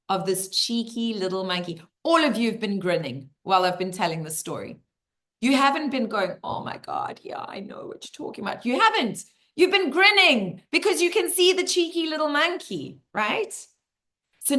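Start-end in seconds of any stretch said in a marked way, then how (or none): 6.4-8.6 mock glum agreement. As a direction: imitate a listener agreeing under the breath. Mimics glum listeners' imagined reaction.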